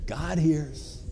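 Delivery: softly, dropping the volume right down; hushed answer. Real, not acted. Preacher reminding the congregation who is listening, hushed.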